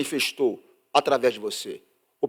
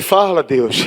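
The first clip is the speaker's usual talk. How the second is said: loud exasperated aside; mock exasperation; exasperated quip; 2 semitones higher, 12 dB louder, slower than usual. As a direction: blurt it out fed up. Exasperated near-reply.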